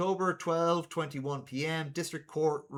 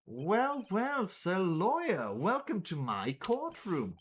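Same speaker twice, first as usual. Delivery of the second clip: slow, swooping theatrical greeting; self-important sleuth savouring each word; pompous detective; 6 semitones higher, slower than usual. Acted. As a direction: welcome him with grand, drawn-out relish. Voices Atmey greeting the lawyer.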